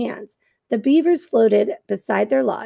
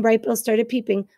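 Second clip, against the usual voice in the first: ordinary delivery, nothing marked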